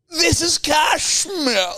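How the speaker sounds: high, slow, hammed-up British aristocrat; British aristocratic accent. Mimicking Louisa taking off her layer, British aristocratic accent. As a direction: stretch it out in a high upper-crust British voice. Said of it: "very distinct British aristocratic accent".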